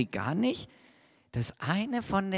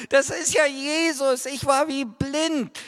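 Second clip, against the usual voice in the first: higher, amazed exclamation; joyful surprise; 10 semitones higher than usual. Acted. Maria suddenly recognizes Jesus, amazed. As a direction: let the realization burst out.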